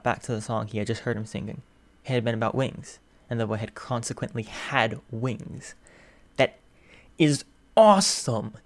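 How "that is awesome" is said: loud, drawn-out cry of delight; That, then a big slow loud is awesome; excited shout. A narrator's reading. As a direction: burst out with amazement. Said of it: "the raised volume".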